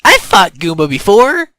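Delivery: loud, high relief; relieved exclamation. A narrator's reading. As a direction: call it out, relieved. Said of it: "said with some relief in his voice".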